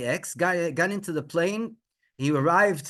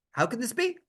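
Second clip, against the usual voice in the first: high-pitched, baffled question; bewildered disbelief; 10 semitones higher than usual. Retold or imitated. Son and wife overwhelmed by the news, bewildered. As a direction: ask it in amazement. Couple's bewildered reaction voiced.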